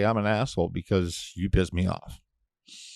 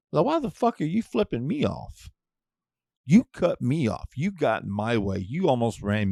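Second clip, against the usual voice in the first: indignant rant; 4 semitones higher than usual. Retold or imitated. Ranting at driver.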